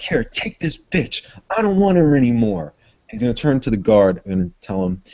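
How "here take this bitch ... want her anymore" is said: contemptuous shove. Acted. Jacob shoves woman at priest.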